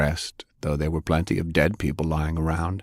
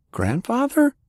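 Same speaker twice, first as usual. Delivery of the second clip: high, startled question; can't believe who it is; very high disbelieving query; bewildered. Acted. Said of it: "Francis asked in bewilderment".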